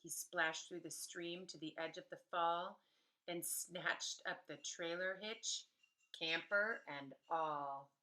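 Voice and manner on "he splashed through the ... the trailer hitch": ordinary delivery, nothing marked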